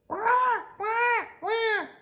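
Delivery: very high wailing wah; mock baby crying wah wah wah.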